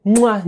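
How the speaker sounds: loud smacking kiss noise, hammed-up; mock kiss sound. Mocking the mistletoe kiss, loud hammed-up smooch. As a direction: plant a big exaggerated smooch.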